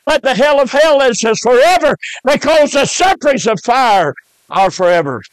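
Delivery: high-pitched, driving sermon cadence; fervent hellfire preaching. Real, not acted. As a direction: preach it urgently, voice lifted high. Preaching at full force.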